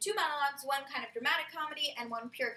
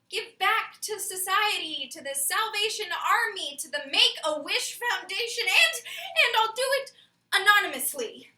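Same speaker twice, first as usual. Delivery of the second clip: loud list building higher; mounting comic horror; 7 semitones higher, 10 dB louder, slower than usual. Acted. Dreading a lifetime of giving back to society, mounting horror. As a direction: escalate each item into an appalled outburst. Horrified list of future charity.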